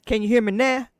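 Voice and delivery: loud test call into the mic; loud mic check. Shown as a demonstration. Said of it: "my gain is down".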